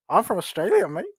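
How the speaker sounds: a weak attempt at an Australian accent, rising at the end; jokey, half-hearted Australian accent; bad Aussie accent. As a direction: caller tries an Australian accent and admits it's horrible. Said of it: "I don't think I can do a good Aussie accent".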